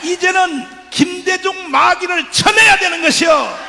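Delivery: a preacher rousing a rally; fiery rally preaching. Acted. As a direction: preach it at the crowd full force. Rally sermon, full force.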